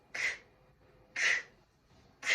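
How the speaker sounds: "k" as short voiceless bursts; letter-sound demo. Shown as a demonstration. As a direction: separate "k" sounds, not the letter name. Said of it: "K K O Kangaroo says".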